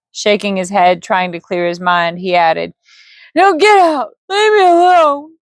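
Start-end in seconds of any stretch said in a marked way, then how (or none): 3.3-5.4 put-on high, loud drunken shout; grieving drunk throwing the wizard out; anguished yell. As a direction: drive him out, voice cracking high. Grieving drunk orders him out.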